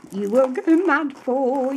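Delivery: high, bright sing-song "you will go mad for"; excited. Real, not acted. Crafter teasing viewers about her favourite fabric, excited. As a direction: tease the viewer with delight. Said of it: "I was doing a little dance".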